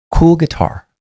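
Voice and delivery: high, light, kind compliment; kind and casual. Retold or imitated. Officer complimenting a man's guitar, high kind voice. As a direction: say it kindly, not sarcastic. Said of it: "succinct and it was kind".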